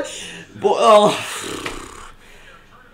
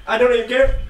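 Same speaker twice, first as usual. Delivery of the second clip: ordinary talk, nothing marked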